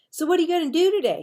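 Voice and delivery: fast, high and loud eager question; excited kid asking his friends. A narrator's reading. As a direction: blurt it out hopefully. Marvin eagerly asks friends.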